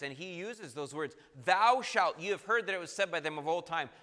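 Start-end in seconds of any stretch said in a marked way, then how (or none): 1.4-2.2 THOU SHALT punched louder and higher; emphatic commandment. Retold or imitated. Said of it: "Very emphatic, emphatic words".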